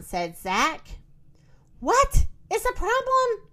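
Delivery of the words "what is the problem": ordinary delivery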